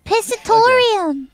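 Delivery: loud proud exclaim. Real, not acted. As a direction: proclaim the word loudly. Triumphant repeat of joke word.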